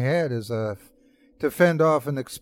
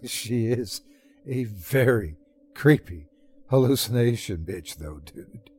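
laughing. Acted. Narrator laughs back at his friend's joke, laughing while talking. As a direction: giggle it out, tipsy and amused. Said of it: "I laughed".

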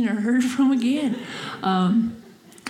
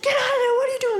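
high-pitched retold yell, no real volume; mock shouting; 13 semitones higher, much faster than usual. Truck driver orders her out of the spot, mock shouting. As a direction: imitate an irate driver yelling from his window. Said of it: "he's just shouting at me".

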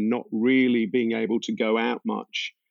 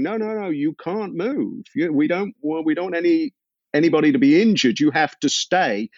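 mild imitation of panicked shouting, higher pitched; urgent higher-pitched plea, not a full shout; retold urgent shouting; 5 semitones higher than usual. Band shouting at their driver not to move, mock urgent yell. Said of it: "we were all shouting at the driver".

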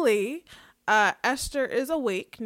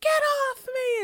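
mock scream, pitched far higher; imitating Esther shoving her away; a hammed-up retelling of a panicked yell; retold yell; 5 dB louder than usual. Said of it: "Esther's like".

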